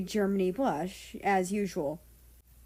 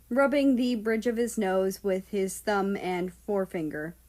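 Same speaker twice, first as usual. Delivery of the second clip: usual speaking voice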